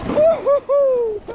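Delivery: long, high stretched whoop; excited whoop. Real, not acted. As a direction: cheer out slowly and joyfully. Cheering while riding down.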